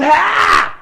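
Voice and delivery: high hammed-up yell; a silly yelp while chasing fish; mock yell. Swimming after the fish, hammed-up high yelp.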